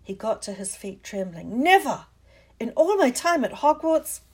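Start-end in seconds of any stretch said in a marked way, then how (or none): 1.6-4.2 loud, higher, outraged; barely able to speak for shock; shocked outburst. Acted. McGonagall scolds Harry in loud, high shock.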